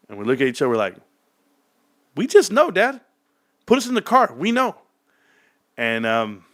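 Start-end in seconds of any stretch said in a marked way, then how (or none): 2.1-4.7 put-on high, sure-of-itself kid; insistent kid voice. Kids telling dad to trust them, high insistent voice. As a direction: insist like a confident ten-year-old.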